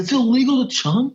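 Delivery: high, rising retold disbelief; incredulous question. Retelling his shock at the captain over chum, incredulous. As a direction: repeat it back in high-pitched disbelief.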